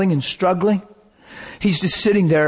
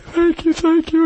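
much higher, gushing thanks; elder sister thanking the preacher, overcome; grateful daughter; 14 semitones higher than usual. Retold or imitated. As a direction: pour out thanks in a high voice. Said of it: "She's like".

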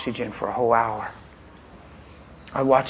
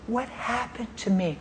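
higher, soft confused question; wife confused; 8 semitones higher, 7 dB quieter than usual. Retold or imitated. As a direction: voice her dazed wondering. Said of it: "She said".

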